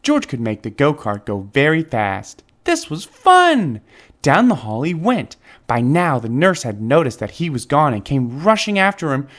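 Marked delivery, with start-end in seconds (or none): 2.6-3.8 high, slow, loud delight; gleeful. A narrator's reading. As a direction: savour the fun. Excited narration.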